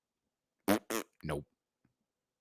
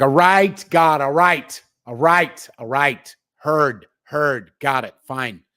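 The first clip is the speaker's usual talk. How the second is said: giving in to God; 2 semitones higher, 8 dB louder, slower than usual. Retold or imitated. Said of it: "I'm like".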